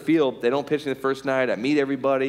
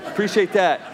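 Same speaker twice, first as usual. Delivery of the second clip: louder, higher mock gratitude; acted sarcastic appreciation; sarcastic thanks; 7 semitones higher, 4 dB louder than usual. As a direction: thank the heckler through your irritation. Mock thanks to heckling fan.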